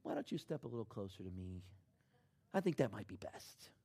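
put-on a meek father coaxing his boy gently; timid soft dad. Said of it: "would get out of the car and go".

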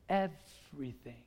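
slow, soft, low syllable by syllable; dramatic hush. Real, not acted. Preacher reveals Samson told her all, dramatic hush. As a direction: draw out EVERYTHING quietly for weight.

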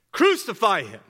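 loud shout falling away at the end; loud mob chant. Acted. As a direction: call it out like a maddened crowd. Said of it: "but they kept shouting".

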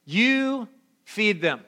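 slow, measured Jesus; a hint of calm authority; Jesus answering calmly. Jesus telling the disciples, lightly voiced, slow and calm. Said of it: "And he says this".

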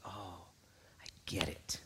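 soft, whispered dawning insight; hushed realization. Acted. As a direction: whisper it as it sinks in. Asaph quietly realizing in church.

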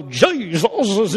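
mock showy preacher shout of JESUS; cool preacher guy. Speaker mimicking cool guys who make people fall out, hammed-up. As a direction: imitate a drawn-out revival-style cry. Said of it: "those cool guys who go".

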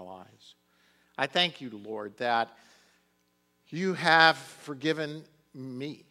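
loud, fervent prayer to the Lord; impassioned prayer. Acted. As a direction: pray aloud with full conviction. Public prayer from pulpit.

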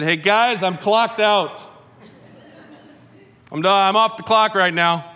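playfully hollering that the shift is over; mock calling out. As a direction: call it out to a crowd, hammed up. Imagines calling off the waiting crowd.

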